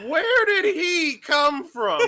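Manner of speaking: high, slow laughing question; laughing disbelief. Real, not acted. Laughing shock at new character.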